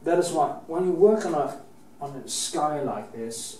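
ordinary talk

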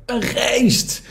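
high, startled cry of fear; frightened cry. Acted. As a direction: cry out as if seeing a ghost. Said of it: "Ze riepen". (angstige kreet, hoog)

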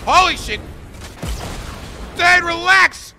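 high, loud yelling; loud frantic shouts; startled shout. Real, not acted. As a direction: yell in panic at the attacker. Shocked mid-fight.

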